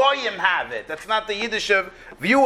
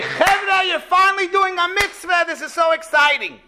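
excited; 8 semitones higher than usual. Pretend. Shmuel imagined welcoming the request.

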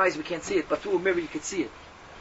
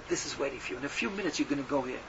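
ominous hush; 4 semitones lower, 6 dB quieter than usual. Acted. Angels show him Gehenna.

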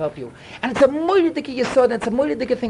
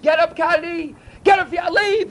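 roaring wake-up call; 7 semitones higher, slower than usual. Retold or imitated. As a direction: rouse yourself out of bed with a raised call. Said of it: "like that, roaring".

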